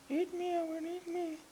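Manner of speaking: high squeaky voice of a sausage. Pretend. A high squeaky sausage pleading to Owen. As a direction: talk as a very high, tiny sausage begging. Voicing sausage talking sexily.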